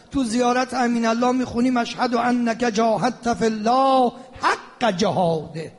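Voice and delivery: ordinary delivery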